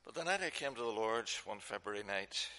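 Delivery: ordinary delivery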